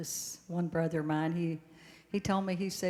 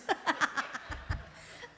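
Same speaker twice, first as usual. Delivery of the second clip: high cackling laugh; 9 semitones higher than usual. Real, not acted. Laughing at her own joke.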